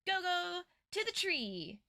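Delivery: ordinary delivery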